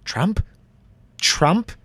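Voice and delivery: high, stunned rising question; can't believe it, pitch soaring up; incredulous. Acted. MP still shocked by the election, incredulous high rise.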